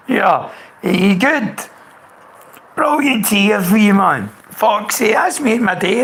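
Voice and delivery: beaming hello to a mate back in touch; excited greeting. Real, not acted. Man greets returning viewer on his stream, delighted and loud.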